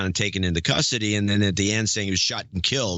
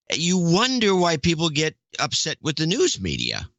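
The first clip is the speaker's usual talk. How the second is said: ordinary talk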